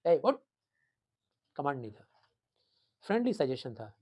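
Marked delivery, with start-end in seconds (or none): 0.1-0.4 louder barked order; mock parent's rough order; harsh command. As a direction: snap it like an impatient parent. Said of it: "जैसे mummy daddy command देते थे".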